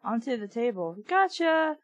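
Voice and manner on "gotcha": high triumphant gotcha; gleeful cry. A narrator's reading. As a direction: crow it, pleased the trick worked. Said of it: "I cried".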